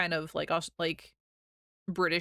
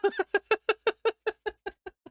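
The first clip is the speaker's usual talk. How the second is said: high, helpless burst of laughter; real laughing fit; 13 semitones higher than usual. Real, not acted. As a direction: crack up in a long, high-pitched giggling fit. Breaks up at own innuendo.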